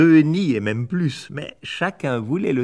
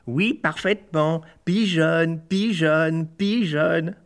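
higher, sing-song repeated insult; higher mocking chant; taunting; 6 semitones higher, slower than usual. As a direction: taunt her with each pigeonne.

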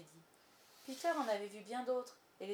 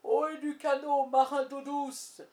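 booming pirate captain's hail; Crochet; 6 semitones higher, 11 dB louder, slower than usual. A narrator's reading. Peter fooling the pirates, booming imitated captain's hail. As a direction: bellow it high and drawn out across the water. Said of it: "il se mit à imiter la voix de Crochet".

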